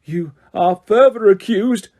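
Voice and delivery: slow, high, loud accusation; bellowed. A narrator's reading. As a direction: boom each word slowly. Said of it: "bellowed Mister Crouch".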